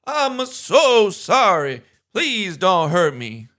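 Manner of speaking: high, slow, loud cowering plea; mock pleading. Opening the video with a loud, high mock plea for mercy. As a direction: beg for mercy in a high, slow put-on whine.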